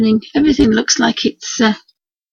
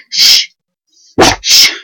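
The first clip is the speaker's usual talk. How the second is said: loud shushing; 8 dB louder than usual. Real, not acted. Hushing the barking dog.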